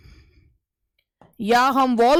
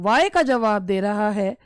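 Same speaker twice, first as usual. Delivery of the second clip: normal talking voice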